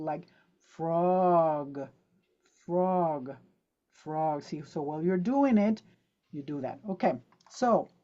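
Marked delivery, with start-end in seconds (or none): 0.0-4.4 "frog" with a long drawn-out o before the g; stretched vowel frog. Shown as a demonstration. Teacher shows students the long vowel in frog. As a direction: stretch the o in "frog" slowly, fading. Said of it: "stretch it while you are pronouncing".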